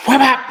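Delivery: loud, high whap sounds; imitated rag whip. Telling how mom hit a teen with a rag, loud imitated whaps. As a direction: crack it out like a snapping wet rag.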